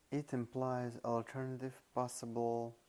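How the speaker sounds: usual speaking voice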